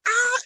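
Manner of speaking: high, loud fangirl squeal; excited squeal. Retold or imitated. Couple thrilled after the actor replied, excited squeal. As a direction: reenact a starstruck shriek. Retold thrill at star's reply.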